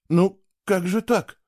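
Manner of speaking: high, slow lost question; stunned boy asking how it can be; bewildered. Acted. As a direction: ask it dumbstruck. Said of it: "растерянно спросил он".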